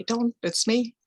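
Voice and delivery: mildly alarmed in-character plea; anxious pleading. Acted. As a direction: plead not to be attacked, voice lifting. Acted plea with hands raised.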